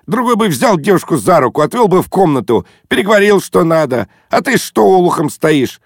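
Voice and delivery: high, energetic chiding; brash scolding. A narrator's reading. Guest chiding his timid friend as suitor, brashly. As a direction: scold him with breezy scorn.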